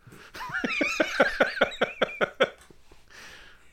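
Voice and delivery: long high wheezing laugh; loud helpless laughter. Real, not acted. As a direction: crack up for a good while. Laughing at the Italian bit.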